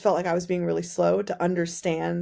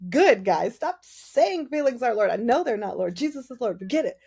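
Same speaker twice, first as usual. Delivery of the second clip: salty complaint; 6 semitones higher, 4 dB louder than usual. Retold or imitated. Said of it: "I was so salty with that".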